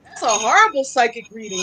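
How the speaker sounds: ordinary delivery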